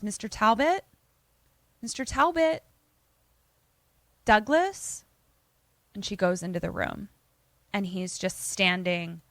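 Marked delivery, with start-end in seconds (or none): 0.0-5.0 higher, questioning call with rising end; calling out for someone. Retold or imitated. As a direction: call tentatively into another room. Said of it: "but she calls".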